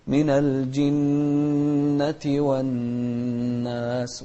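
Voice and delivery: very slow "من الجنة والناس", each doubled nun hummed long; nasalised double nun. Shown as a demonstration. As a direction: hold both nuns of "من الجنة والناس" in the nose. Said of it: "نغنها بمقدار حركتين". (غنة ممدودة في نوني "من الجنة والناس")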